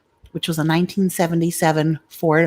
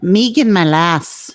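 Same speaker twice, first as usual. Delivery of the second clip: Irish accent; 2 semitones higher, 6 dB louder, slower than usual. Shown as a demonstration. Playing with the listener's name, in a hammed-up Irish accent. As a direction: call it out in a big Irish accent. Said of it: "should I say it with an Irish accent".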